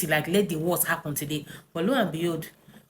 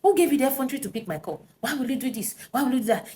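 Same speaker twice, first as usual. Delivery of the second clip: high, fast accusing rant; retold angry shouting; 6 semitones higher, faster than usual. Husband yelling at wife over the answered call. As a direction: hurl the questions at her in outrage. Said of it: "this man started shouting".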